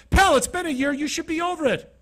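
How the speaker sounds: high, loud, scornful snap; irritated driver brushing off a nervous passenger; angry cab driver. Retold or imitated. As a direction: bark it like a rude cabbie.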